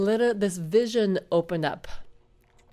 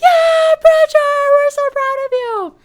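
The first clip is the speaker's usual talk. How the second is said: very high, loud, squealing cheer; cheering angels; 12 dB louder, slower than usual. Retold or imitated. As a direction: scream with joy like a crowd of fans. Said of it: "started screaming and clapping and cheering".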